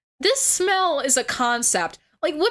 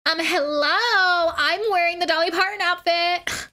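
mock whiny streamer; 7 semitones higher than usual. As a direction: whine like a spoiled girl showing off her outfit. Mocking a streamer in costume.

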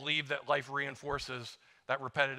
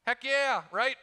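higher, louder cheer; excited heck yeah; 8 semitones higher, 5 dB louder, slower than usual. Pretend. Preacher cheers as a man getting a promotion, excited. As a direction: cheer it like a fan.

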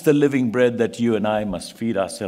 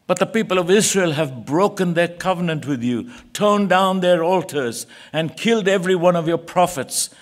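higher and louder, an anguished prophet pleading; Elijah's desperate plea; 7 semitones higher, 4 dB louder than usual. Acted. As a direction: cry it out to God, worn out and desperate. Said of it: "And Elijah replied".

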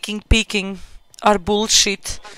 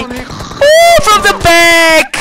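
high whooped "ooh", then slower; startled exclamation; 4 semitones higher, much slower than usual. Real, not acted. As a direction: yelp as if caught from behind. Startled by attack from behind.

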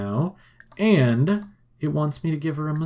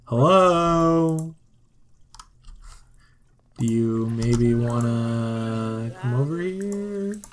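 drawn-out, hesitant invitation; tentative calling out; much slower than usual. Pretend. Player as Sim coaxing a distant date over, drawn-out and tentative. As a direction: stretch the words out slowly, lifting at the end.

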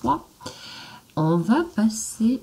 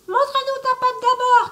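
put-on much higher, louder childlike cry; little goat kids shouting; 7 dB louder than usual. The little goats shout at the wolf, high and loud. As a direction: yell it like tiny goats who caught him. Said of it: "crièrent les chevrons".

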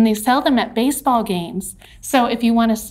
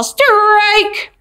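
high, loud, drawn-out umpire call; umpire's yell; 8 semitones higher, 5 dB louder than usual. Acted. Teacher imitates an umpire at softball, yelling. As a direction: yell it out like an umpire calling the pitch. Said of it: "the umpire would yell".

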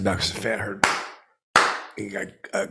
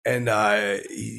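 ordinary talk

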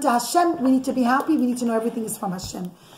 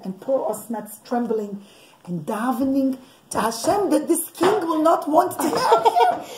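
ordinary delivery

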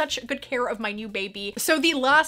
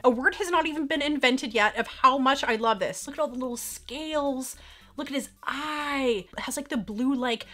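ordinary talk, nothing marked

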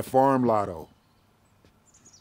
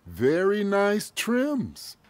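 slow, higher-pitched admiring appraisal; drawn-out praise; 8 semitones higher, much slower than usual. Acted. Boss hands out pay and praises a worker's trims, appreciatively. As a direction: stretch out the compliment, lifting the pitch.

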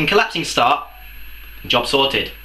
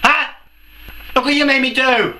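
indignant; 8 semitones higher than usual. Real, not acted. Outraged rant at fans.

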